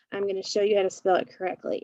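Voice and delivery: ordinary delivery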